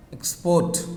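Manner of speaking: "export" with the second syllable stressed; stress on last syllable. Shown as a demonstration. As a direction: put the weight on the end of "export". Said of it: "on last syllable".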